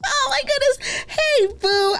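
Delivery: very high squealed oh my goodness; overjoyed high-pitched exclamation; squealing excitement. Real, not acted.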